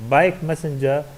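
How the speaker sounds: BIKE stressed, messenger falling away. Shown as a demonstration. Teacher repeating the compound example, stress on BIKE. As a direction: lean on bike, keep messenger lighter. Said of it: "the stress is on the first one".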